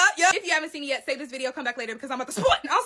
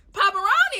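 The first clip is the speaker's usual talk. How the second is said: high, louder playful call; high teasing callout; 8 semitones higher, 5 dB louder than usual. Real, not acted. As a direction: call his nickname out. Calling out the dad.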